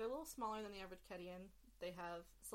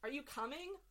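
loud, higher call through the door; 9 semitones higher, 10 dB louder than usual. Acted. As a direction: call out from another room. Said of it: "you hear a voice like".

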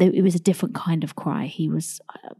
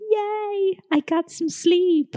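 pretend joy; 12 semitones higher, much slower than usual. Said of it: "I can be like".

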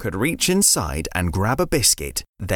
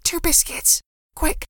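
urgent whisper into the cupboard; hushed, hurried whisper. Acted. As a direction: breathe it so Nan can't hear.

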